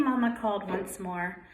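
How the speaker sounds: plain reading voice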